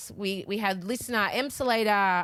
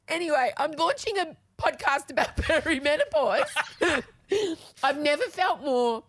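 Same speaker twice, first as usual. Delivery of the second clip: laughing through tears; 6 semitones higher than usual. Real, not acted. Laughing through tears after crying.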